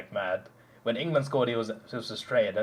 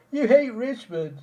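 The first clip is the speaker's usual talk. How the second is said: high, drawn-out whiny imitation; put-on crybaby complaint, pitched way up; mock crying fan; 11 semitones higher, 4 dB louder, slower than usual. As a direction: whine it slowly like a tearful fan. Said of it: "they start crying".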